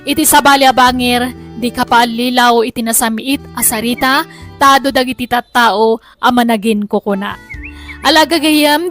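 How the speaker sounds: ordinary talk, nothing marked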